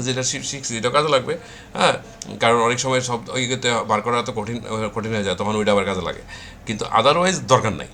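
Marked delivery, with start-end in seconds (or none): none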